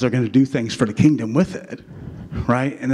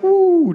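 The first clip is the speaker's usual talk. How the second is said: mock outburst whoop; 5 dB louder than usual. Mock wife blowing up.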